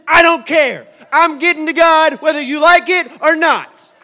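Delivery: loud, defiant declaration; defiant shout. Pretend. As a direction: shout it like nothing can stop you. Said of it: "faith says".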